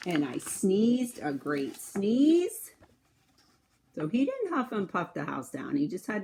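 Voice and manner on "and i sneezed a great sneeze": slow, drawn-out telling; slow dramatic. Acted. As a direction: linger on the big sneeze. Wolf telling the big sneeze.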